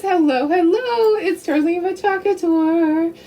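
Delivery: normal talking voice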